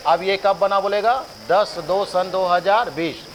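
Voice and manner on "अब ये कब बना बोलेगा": ordinary talk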